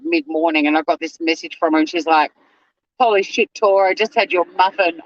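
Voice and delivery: usual speaking voice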